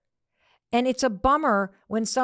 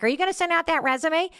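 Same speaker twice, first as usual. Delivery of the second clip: nagging question; 9 semitones higher, faster than usual. Acted. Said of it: "He would explode at you".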